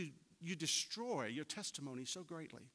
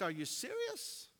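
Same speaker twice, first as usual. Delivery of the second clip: disbelief; 10 semitones higher, slower than usual. Retold or imitated. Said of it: "and it was like".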